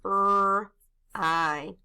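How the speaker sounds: R and I said as drawn-out separate sounds; sounding out. Shown as a demonstration. Teacher writing fry with pupils, sounded out. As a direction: give R and I each its own slow sound. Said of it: "Let's write it".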